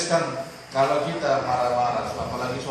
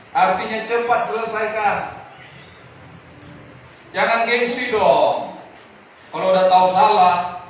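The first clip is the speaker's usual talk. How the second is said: loud, higher preacher's delivery; preaching at full force; 5 semitones higher, 7 dB louder than usual. Acted. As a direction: hammer the warning to the congregation. Preaching urgently. (khotbah berapi-api)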